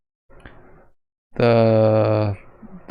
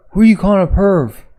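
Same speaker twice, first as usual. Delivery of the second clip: higher, louder, quick comeback; indignant retort; 7 semitones higher, 5 dB louder, faster than usual. Acted. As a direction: fire it back, offended. Acted quarrel from the manga.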